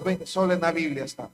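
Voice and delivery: usual speaking voice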